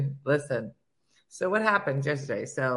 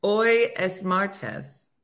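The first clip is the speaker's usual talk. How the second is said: slow careful Spanish accent; 2 semitones higher, much slower than usual. Shown as a demonstration. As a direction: spell out the Spanish slowly. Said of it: "Can I use it in a sentence".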